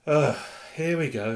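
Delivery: loud groan of resentment; bitter. A narrator's reading. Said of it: "said Nearly Headless Nick bitterly".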